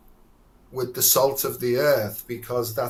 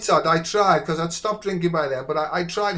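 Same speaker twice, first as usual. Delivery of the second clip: ordinary talk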